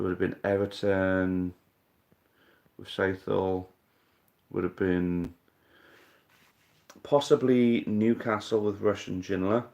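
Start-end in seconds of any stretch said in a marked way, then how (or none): none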